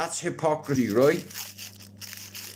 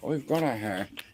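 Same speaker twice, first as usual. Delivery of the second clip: ordinary talk, nothing marked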